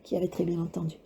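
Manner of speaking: usual speaking voice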